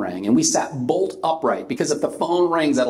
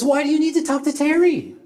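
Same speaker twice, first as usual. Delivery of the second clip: put-on high, wary drunk voice; high and suspicious, like a tipsy man; a drunk caller growing suspicious, high; 13 semitones higher than usual.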